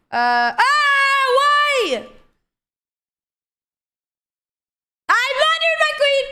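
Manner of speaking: high drawn-out wail of dismay; anguished cry. Real, not acted. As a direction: cry out in shock. Sudden blunder in bullet.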